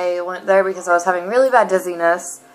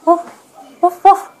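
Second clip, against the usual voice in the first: high playful barking sounds getting louder; doggy woofs; 10 semitones higher, 5 dB louder, much slower than usual. Shown as a demonstration. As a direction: bark like a small dog for the kid. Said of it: "I'm making doggy noises".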